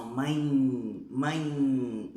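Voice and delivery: MÃE drawn out through the nose; nasalised. Shown as a demonstration. Teacher showing what the til does, nasalised. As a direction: send the vowel of MÃE into the nose. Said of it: "eu vou nasalizar o som".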